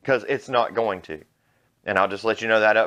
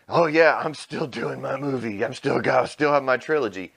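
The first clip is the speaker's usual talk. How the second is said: mocking impression; 4 semitones higher than usual. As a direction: parody his smug boast. Mocking Rian Johnson's boast.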